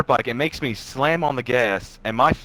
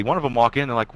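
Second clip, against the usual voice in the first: normal talking voice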